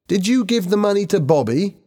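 high-pitched urgent demand; shouting. A narrator's reading. Helen questions the boy about the money, shouting. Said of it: "she shouted".

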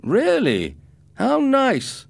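loud, high mocking sarcasm; sarcastic. Bully mocks Ricardo's answer, loud high sarcasm. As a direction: sneer it with fake delight. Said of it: "answered the boy sarcastically".

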